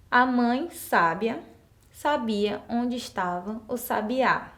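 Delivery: sábia, sabia, sabiá read slowly; careful teacher dictation. Shown as a demonstration. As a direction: read it slowly so each accent is heard. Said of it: "Eu vou fazer a leitura pra vocês".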